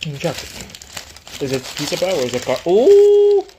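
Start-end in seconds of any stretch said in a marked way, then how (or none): 2.7-3.4 high loud ooh of delight; surprised ooh. Real, not acted. Tasting food reaction.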